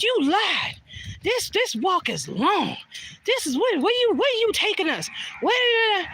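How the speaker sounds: high, mock-indignant complaining; whiny complaint. Friends complaining she lied about the walk, high whiny protest. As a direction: play the annoyed friends whining about the long walk. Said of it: "they said".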